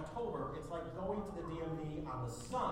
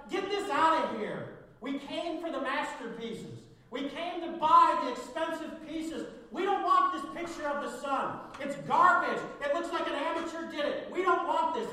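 angry crowd shouting; 8 semitones higher, 9 dB louder than usual. Acted. Angry bidders shouting at the auctioneer, loud furious yelling. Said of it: "People started shouting".

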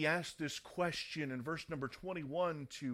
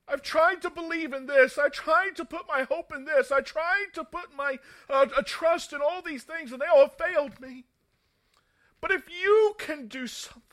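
desperate father's loud, high-pitched plea; impassioned, loud pleading, softening on the final appeal; passionate plea; 11 semitones higher, 14 dB louder than usual. Acted. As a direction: cry out for help with everything you have, voice raised. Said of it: "see the passion that this father had in his voice".

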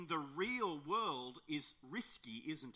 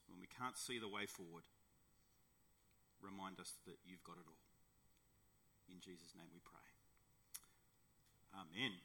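prayer; 5 semitones lower than usual. Real, not acted. Pastor praying to Heavenly Father, reverent prayer.

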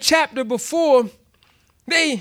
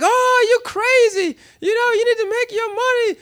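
mocking relatives; 10 semitones higher than usual. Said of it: "even my family, like".

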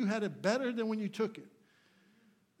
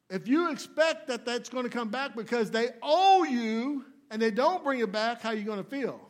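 forceful preaching; 6 semitones higher, 10 dB louder than usual. Real, not acted. Preacher warning congregation about expecting repayment, forceful preaching. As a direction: hammer it home at raised pitch and full volume.

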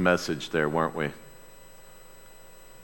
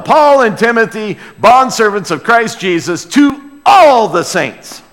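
"Paul and Timothy" called out louder and higher, slowing on the last line; proclaimed greeting; 5 semitones higher, 5 dB louder than usual. Shown as a demonstration. Pastor modelling a bold greeting to the church, louder. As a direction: announce it like you're greeting a room. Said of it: "Let, let them know".

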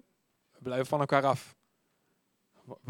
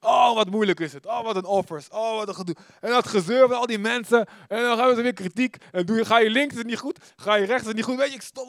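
higher, louder mock whine about the offers; whiny complaining; 7 semitones higher, 7 dB louder than usual. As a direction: moan like someone fed up with church. Mocking own complaining inner voice. (zeurderig en luid klagend)

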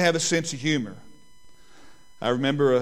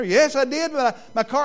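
higher, flustered son protesting; upset son; 8 semitones higher than usual. Retold or imitated. Pastor voicing his son missing his car, higher and flustered. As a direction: stammer like a worried teenager.

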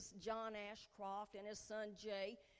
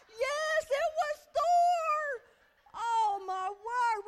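starstruck squeal; 14 dB louder, much slower than usual. Retold or imitated. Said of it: "And I'm like".

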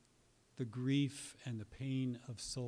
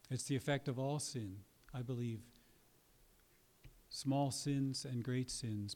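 usual speaking voice

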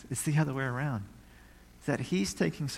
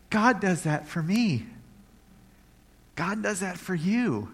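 tender wonder; 5 semitones higher than usual. Real, not acted. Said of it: "doing this thing".

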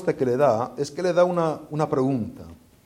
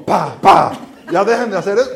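sharp louder scold; 4 semitones higher, 9 dB louder than usual. Retold or imitated. Preacher voicing his own urge to scold mother and child, loud. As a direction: snap at them to stop it.

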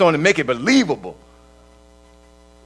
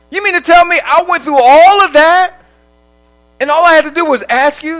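much higher and louder disbelief; incredulous outburst; 9 semitones higher, 9 dB louder than usual. Retold or imitated. Believer answering God, loud incredulous outburst. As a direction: blurt it out in shocked disbelief.

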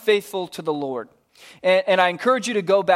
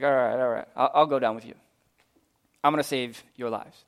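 lower, softer, unbothered refusal; casual dismissive prophet, lower and quieter; laid-back Elijah; 6 semitones lower, 4 dB quieter, faster than usual. Acted. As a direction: shrug off the threat like it's nothing. Elijah voiced agreeing.

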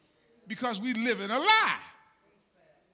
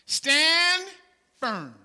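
proclaimed loud; 6 semitones higher, 9 dB louder than usual. Shown as a demonstration. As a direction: STAND called out high and loud, firm dropped. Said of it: "here's what he says in verse fourteen".

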